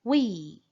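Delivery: "We" said as "ui" for the class to echo; repeat after me. Shown as a demonstration. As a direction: model "We" for students to repeat. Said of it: "repitam comigo".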